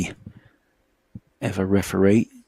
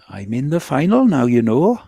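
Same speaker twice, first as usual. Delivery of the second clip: higher, louder Welsh lilt; Terry Griffiths Welsh accent; 6 semitones higher, 6 dB louder than usual. Retold or imitated. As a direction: Welsh accent, proud and delighted. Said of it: "In his beautiful Welsh accent".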